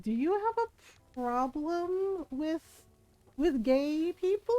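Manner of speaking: high, slow, stunned disbelief; incredulous question. Retold or imitated. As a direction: ask it as if you can't believe your ears. Stunned question to daughter's friend.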